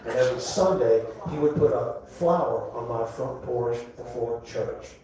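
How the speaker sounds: soft, low reminiscing as a grandmother. Acted. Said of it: "She said".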